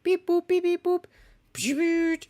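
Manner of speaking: high beep-boop machine noises; robot beeps. Retold or imitated. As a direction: make robot computer sounds.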